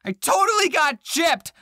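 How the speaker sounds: mock outrage, called out to the viewers; indignant outburst. Real, not acted. Vlogger complaining about a sale hamster, mock outraged shout.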